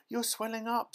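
very high-pitched panicked cry; scream. A narrator's reading. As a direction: shriek it in horror. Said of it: "screamed Missus Beauregard again".

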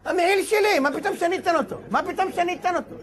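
high, indignant refusal; possessive protest. Acted. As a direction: balk as if it's your own. Rabbi acts out his possessive reaction.

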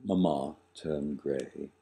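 usual speaking voice

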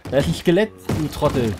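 high, loud frantic shout; panicked yell. Real, not acted. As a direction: yell at your buddy in panic. Skeleton attacks, yells at friend.

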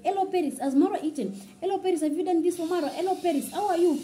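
high-pitched, sweetly attentive mother imitation; sweet mom. Friend's mom checking in on Paris, sweet high voice. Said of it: "Congrats' mom is so sweet".

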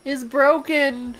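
high, slow hammed-up wail; mock despair. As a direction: drag out the grief for laughs. Mock despair at broken egg.

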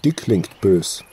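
plain reading voice

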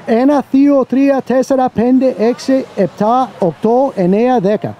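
Ένα to δέκα counted in Greek, each number said separately; counting in Greek. Shown as a demonstration. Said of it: "I can count from one to ten in Greek".

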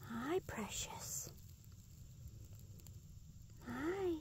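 hushed, coaxing whisper; soft whisper. Real, not acted. Whispering to a wild possum to calm it. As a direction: whisper gently to a frightened animal.

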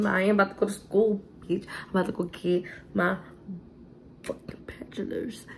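usual speaking voice

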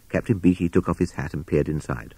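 ordinary delivery

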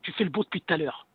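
ordinary talk

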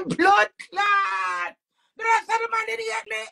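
high, loud cry through laughter; laughing shout. Retold or imitated. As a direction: burst out yelling while laughing. Laughing outburst retold.

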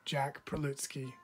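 plain reading voice

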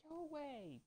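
high, falling whine of annoyance; exasperated cry. Real, not acted. Player shooing a pestering enemy, high and exasperated. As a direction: send them off with a high-pitched plea.

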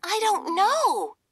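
high squeaky mouse voice; a little mouse pleading to be known; tiny mouse princess. Acted. Mouse Hildy answers.

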